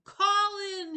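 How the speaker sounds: high, loud scream across flooded halls; desperate scream for his friend; screaming a name. Acted. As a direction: shout his name with everything. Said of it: "he screamed".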